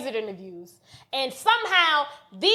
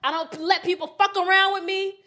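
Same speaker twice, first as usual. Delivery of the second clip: ordinary talk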